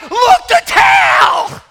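very high, loud, slow thrilled cry; mock gasping tourists. Tourists spotting the whale tail, hammed-up excitement. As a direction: squeal like a crowd seeing the tail. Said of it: "And everybody's like".

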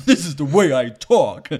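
loud, high put-on voice; high mock voice. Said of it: "He's like".

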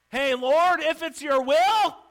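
loud, high, slow call; pleading leper. Acted. Leper calling to Jesus, loud and pleading. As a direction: call out to the Lord.

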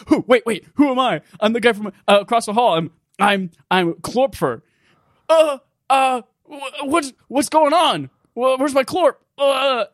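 high, stammering sitcom-character parody; worst Seinfeld impression. Game characters trade sitcom impressions, deliberately awful and high. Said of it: "the worst impressions of Seinfeld".

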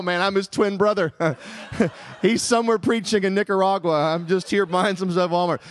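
normal talking voice

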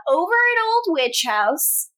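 ordinary talk, nothing marked

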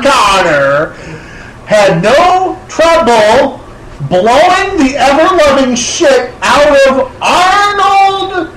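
very slow, spelling it out; explaining slowly to an interrupting friend; slow, emphatic. Real, not acted. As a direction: hammer each word slowly. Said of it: "slow emphatic explanation".

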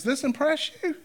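mock amazement at a small thing; lightly voiced amused Jesus; playful disbelief. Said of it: "Jesus looks at Nathanael and goes".

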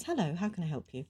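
lightly put-on polite helpdesk voice; chirpy call-centre greeting, lightly done; customer service voice. Shown as a demonstration. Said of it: "a customer service voice".

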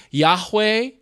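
Yahweh said as YAH-way, with a w; Yahweh pronunciation. Shown as a demonstration. Preacher offering one possible reading of God's name, Yahweh said YAH-way. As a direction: say Yahweh in two syllables, YAH-way. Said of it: "if it's pronounced".

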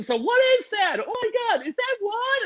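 high squeaky confused voices; mock yelling parents. Mocking the Zoom crowd yelling questions, high and squeaky. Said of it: "all these people yelling".

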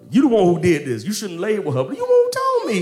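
scold, pitch shooting up at the end; mock accusation. Sarah blaming Abram over Hagar, mock scolding rising high.

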